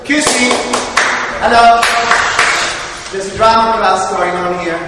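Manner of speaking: loud, playful call to the room; hammed-up loud greeting. Shown as a demonstration. Drama teacher clowning loudly to the class. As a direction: play it big for the class.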